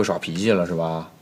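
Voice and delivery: slow, drawn-out sweet talk; coaxing, slow. Acted. Master coaxing the sulking maid, slow and sweet. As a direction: soothe her, stretching the words.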